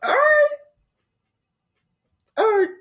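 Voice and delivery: high stretched all right; playful high rapper voice. Retold or imitated. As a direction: channel the rapper's hook. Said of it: "feeling that [name] real quick".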